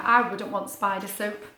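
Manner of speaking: plain reading voice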